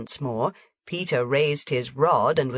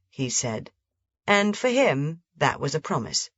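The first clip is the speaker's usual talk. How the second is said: ordinary delivery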